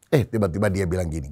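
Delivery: usual speaking voice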